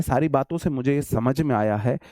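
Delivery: ordinary talk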